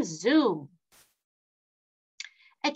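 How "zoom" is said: zoom dropping low and falling away. A narrator's reading. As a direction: let zoom swoop down low. Playful train sound effect.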